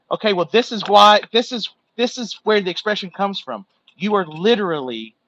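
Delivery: retold scolding. Berating worker in retold quote.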